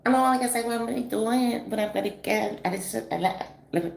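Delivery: high, fast mock imitation of the girl's slang; high, rushed mock slang voice; imitating the girl's lingo. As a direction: rattle it off high and fast like her. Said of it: "went a little something like this".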